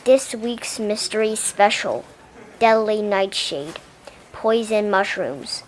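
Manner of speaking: ordinary delivery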